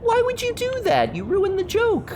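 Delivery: high, whiny mock complaint; mother scolding her kid for ruining her joke, high and whiny; upset mother. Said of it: "she was all upset and was like".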